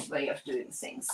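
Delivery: a soft, conspiratorial whisper of "to my chaotic". Real, not acted. Vlogger confesses her chaotic habits, whispering. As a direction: drop to a breathy confession on "chaotic".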